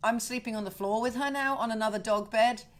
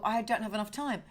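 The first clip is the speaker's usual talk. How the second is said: ordinary talk, nothing marked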